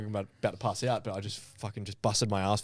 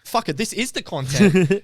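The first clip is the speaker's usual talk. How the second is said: loud, high, slowed exclamation; hyped call to get the camera rolling; excited shout; 9 semitones higher, 10 dB louder than usual. Retold or imitated. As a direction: announce it like a thrilling discovery. Retold excited call to film.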